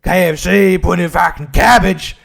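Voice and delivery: hammed-up outraged politician, admittedly inaccurate voice; Anthony Albanese. Comedian guessing at the Prime Minister's rant over cabbage, mock outrage. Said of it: "I have no idea what he sounds like".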